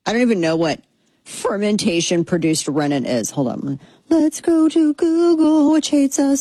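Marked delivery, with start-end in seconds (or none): 4.1-6.4 high, slowed, playful put-on voice; silly high voice. Host announcing an online search, silly high voice. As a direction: chirp it out in a mock-cheery high pitch.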